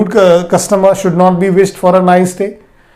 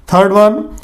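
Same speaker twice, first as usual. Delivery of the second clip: ordinary talk, nothing marked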